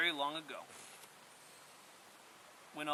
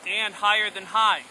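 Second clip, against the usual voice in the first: a much louder, higher proclamation; Old Turtle, ringing out; 5 semitones higher, 15 dB louder than usual. A narrator's reading. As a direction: call it up to the mountain tops. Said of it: "It rumbled loudly like thunder".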